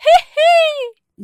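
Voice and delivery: slow, loud, high taunting laugh; mocking cackle. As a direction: drag out a mocking hee hee. Gigi mocks her grandmother.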